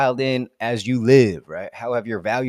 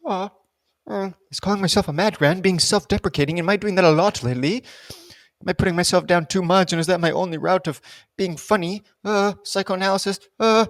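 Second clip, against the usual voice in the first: mock self-analysis; 7 semitones higher than usual. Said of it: "self-questioning aside".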